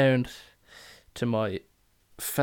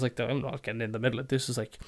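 ordinary talk

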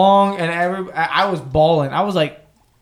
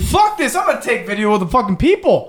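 squeaky, fast imitation of a friend; high mock voice; 5 semitones higher than usual. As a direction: blurt it out high and quick. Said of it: "Lawrence is like".

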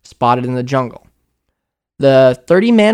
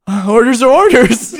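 reluctant soldier; 7 semitones higher, 3 dB louder than usual. Retold or imitated. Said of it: "you look at the seven-foot-tall ape and you're like".

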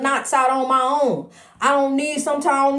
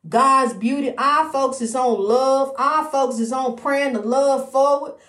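ordinary talk

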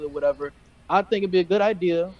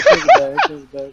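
laughing; 6 semitones higher, 7 dB louder than usual. Real, not acted. Laughing after the reveal.

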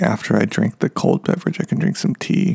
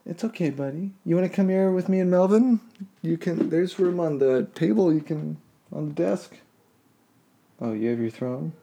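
cooing to the cat; 5 semitones higher, 6 dB quieter than usual. Real, not acted. Owner soothing cat Moose about dogs outside, soft high cooing. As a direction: coo gently to reassure a nervous cat.

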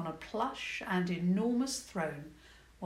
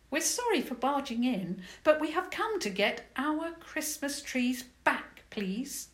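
put-on higher, polite girlish voice; polite young princess; 7 semitones higher than usual. Princess politely asks goblin for trees, higher voice. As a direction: ask nicely like a young princess.

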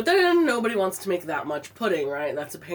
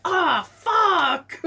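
retold curse; 10 semitones higher, 4 dB louder than usual. Waking up, remembering forgotten task.

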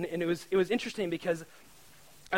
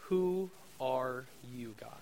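slow hushed question; 5 semitones lower, 6 dB quieter, slower than usual. Retold or imitated. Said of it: "I just wrote down the question".

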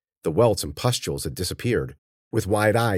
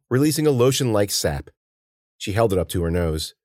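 ordinary delivery

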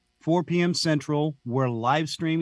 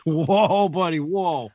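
louder startled whoa, higher at the start; 4 semitones higher, 4 dB louder, slower than usual. Retold or imitated. Bob recoiling from the host's joke, a startled whoa. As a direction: back off in mock alarm. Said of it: "he just".

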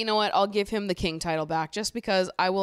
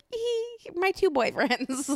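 very high, drawn-out silly squeal; mock giddy; 12 semitones higher, slower than usual. Recapper mocking Tanya bringing another man into villa, mock giddy. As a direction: parody a delighted girlish announcement.

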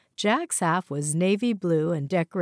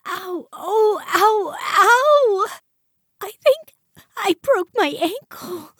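high, loud tipsy whine of pain; giddy; 7 dB louder, slower than usual. Acted. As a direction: wail like a tipsy wife hurt. Wife twisting ankle, crying out.

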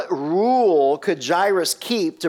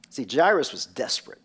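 normal talking voice

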